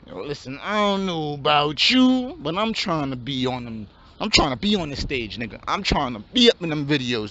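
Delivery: low, laid-back street voice of a hopeful rapper; wannabe rapper. Acted. Aspiring rapper pitches his rap plan to his friend, low and laid-back.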